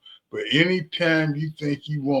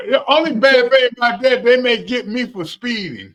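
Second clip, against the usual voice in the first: joking boast; 10 semitones higher, 7 dB louder than usual. Real, not acted. Joking about racing on the street.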